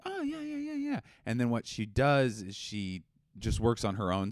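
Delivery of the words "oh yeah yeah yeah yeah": very high mock brush-off; imitating partner. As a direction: squeak out a hammy dismissive yes. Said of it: "she'll kinda be like".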